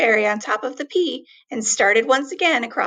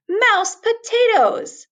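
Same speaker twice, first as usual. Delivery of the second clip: excited exclaim; 8 semitones higher, 4 dB louder, slower than usual. A narrator's reading. Narrator delights at the potatoes.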